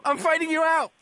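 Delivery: high, loud hammy dying shout; overacted death scene. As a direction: cry it out like a dying man. Second take, shouted dying cry.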